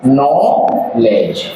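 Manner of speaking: "नॉलेज" drawn out very slowly, silent k; correct pronunciation. Shown as a demonstration. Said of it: "हमारे भाई ने बताया नॉलेज". ("नॉलेज" बहुत धीरे, बिना क के)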